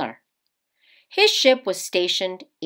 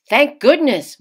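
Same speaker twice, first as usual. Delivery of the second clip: a touch of relief, a little louder; relieved; 7 semitones higher, 7 dB louder than usual. Acted. Father relieved at the waiter's tip, a little louder. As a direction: exhale the relief brightly.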